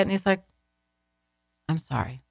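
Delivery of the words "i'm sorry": imitating a quiet, flat apology; flat insincere apology. Ex cornered on the phone about his lies, quiet insincere apology. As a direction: drop low and soft, not meaning it. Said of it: "the most flat, insincere po-, apology".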